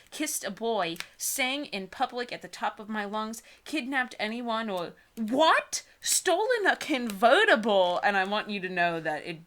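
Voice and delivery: ordinary talk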